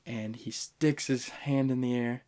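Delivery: ordinary delivery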